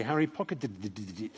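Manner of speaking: "pocketed it" dissolving into stuttered did-did-dit; hopelessly garbled end to "pocketed it"; tongue-tied stumble. Retold or imitated. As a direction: trip over "pocketed it" as if stuck on the d. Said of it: "I can't say".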